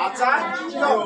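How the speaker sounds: আচার্যম said as a-cha-ri-yom; joined final m. Shown as a demonstration. Teacher joining m to the word, model pronunciation of আচার্যম. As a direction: close আচার্যম on "riyom", the m attached. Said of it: "কি উচ্চারণ করবো".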